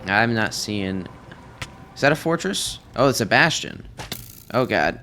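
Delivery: ordinary talk, nothing marked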